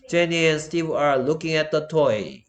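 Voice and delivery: very slow, deliberate English example; slow model sentence. Shown as a demonstration. Teacher reading an example sentence to students, slowly. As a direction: spell the sentence out word by word.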